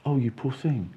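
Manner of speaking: soft, pitying coo, hammed-up; mock pity. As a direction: play the doorstep sympathiser pitying him. Retold pitying doorstep reaction.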